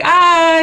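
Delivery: high, loud excited squeal; fanboy freak-out. Retold or imitated. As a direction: shriek like a starstruck fan. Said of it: "he's, like, freaking out".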